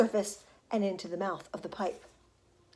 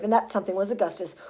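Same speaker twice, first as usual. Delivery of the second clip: usual speaking voice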